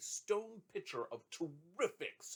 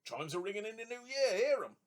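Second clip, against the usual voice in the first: high, loud joyful call; excited cry; 8 semitones higher, 7 dB louder than usual. A narrator's reading. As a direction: call out over the new-year bells. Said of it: "cried Richard".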